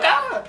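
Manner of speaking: short high yelp; mock jolted "ah"; quick high cry of a crew thrown about; imitated startled cry. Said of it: "everybody would be like".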